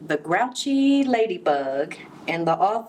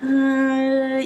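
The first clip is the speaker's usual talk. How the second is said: nervous uh; 7 semitones higher, 4 dB louder than usual. A narrator's reading. Ladybug backs down.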